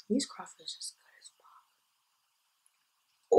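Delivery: slow, soft, toneless whisper. Real, not acted. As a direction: whisper it slowly like a secret. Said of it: "eating while talking".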